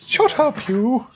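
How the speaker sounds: high, plummy mock-posh put-down. Teasing his partner by copying her posh voice. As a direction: say it like a snooty toff, playfully. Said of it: "what was that in your posh voice?".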